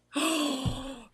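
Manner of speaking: big, loud, high gasp; shocked gasp. Real, not acted. Host sees the friend's surprise, shocked gasp.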